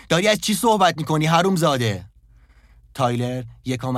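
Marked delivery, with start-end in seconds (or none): 0.1-2.0 high, loud furious yell; loud shout. A narrator's reading. Jeff screaming at Tyler, repeating the question, full shout. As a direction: explode at him. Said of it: "جف فریاد بلندی زد".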